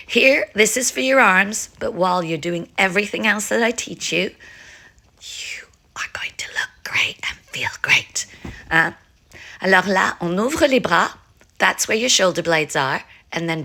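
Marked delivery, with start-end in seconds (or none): 5.2-8.3 soft, voiceless whisper; hushed whispered pep talk; whispered encouragement. Real, not acted. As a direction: whisper it like a secret promise to the viewer. Playful aside whispered to viewers.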